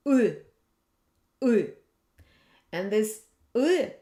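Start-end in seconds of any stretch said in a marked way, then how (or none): 0.1-1.7 "u" as a tight central vowel, like a pulled-back "i"; Russian yery vowel. Shown as a demonstration. Teacher sounds out the yeri letter, hard ы. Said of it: "has a sound".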